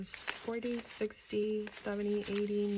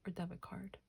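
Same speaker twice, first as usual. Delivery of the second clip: whispered question to herself; breathy unvoiced whisper; 8 dB quieter than usual. Real, not acted. Unsure, thinking to herself.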